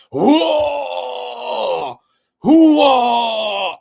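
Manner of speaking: loud, high, strained retching groans; imitating retching. Friend heard through the bathroom door, imitated as loud retching. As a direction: mimic a sick man heaving in the bathroom. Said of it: "he sounded horrible".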